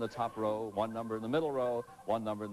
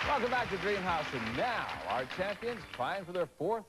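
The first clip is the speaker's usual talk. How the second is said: excited host; 8 semitones higher than usual. Acted. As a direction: greet the audience with energy. Host welcome.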